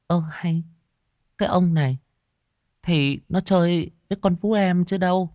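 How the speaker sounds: high-pitched woman's voice, mildly chiding; light high female character voice; puzzled wife. A narrator's reading. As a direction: answer him in a high, surprised tone. Wife answers her confused husband.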